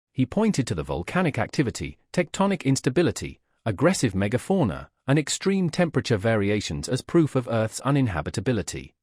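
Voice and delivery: ordinary delivery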